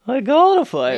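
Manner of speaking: exaggerated chef impression; Chef Kawasaki. Chiming in as the chef firing someone, loud impression. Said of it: "he's like".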